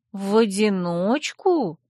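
slow, stretched question; drawn out. Acted. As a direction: stretch every vowel doubtfully. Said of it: "протянула я". (протяжно, недоверчиво)

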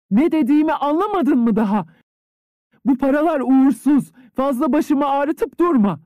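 high, loud and impatient, rising to a cry. A narrator's reading. Mistress losing patience with her maid, high and loud. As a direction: push her away, out of patience. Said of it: "Hanımefendi sabırsızlanıyordu".

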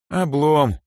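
high, mildly crestfallen; sad sigh. A narrator's reading. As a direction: sigh it out in disappointment. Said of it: "грустно вздохнула Эльза".

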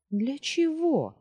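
high, loud, drawn-out demand; exasperated question, pitch leaping up; barely restrained. A narrator's reading. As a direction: ask pointedly, anger barely held in. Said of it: "едва сдерживая себя".

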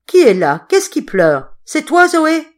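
loud, strong calling out; loud call rising high on the name; loud questioning. Acted. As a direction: call through the door firmly. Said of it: "il questionna d'une voix forte".

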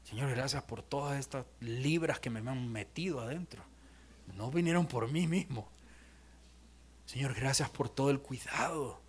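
low, soft words of gratitude; hushed prayer. Acted. Grown child thanking God for parents' care, hushed prayer. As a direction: pray quietly, dropping to a whisper on the thanks. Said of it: "comenzamos a decir".

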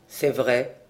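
low, heavy admission, a touch of gloom; dark voice. A narrator's reading. Morvan glumly agreeing with Trémor, low and somber. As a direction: let it drop low and somber. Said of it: "la voix sombre".

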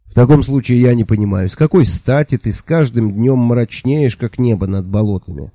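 ordinary delivery

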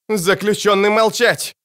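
high, drawn-out barked command; barked order. A narrator's reading. Rona silencing the prisoners, a sharp high-pitched bark. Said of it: "гаркнула Рона".

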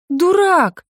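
a mock-offended little shout; playful heroine crying out at her lover; playful outcry.

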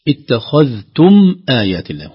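اتخذتم آيات الله slowly, loud, the م sounded; clear mim. Shown as a demonstration. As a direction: let the م of اتخذتم ring out. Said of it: "هنا ظهرت الميم". (إظهار الميم في اتخذتم)

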